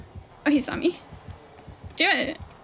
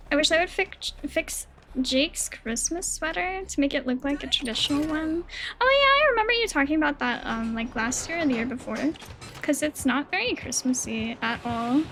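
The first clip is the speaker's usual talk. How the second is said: ordinary delivery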